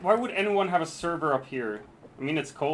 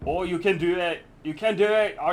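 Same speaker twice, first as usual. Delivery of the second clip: usual speaking voice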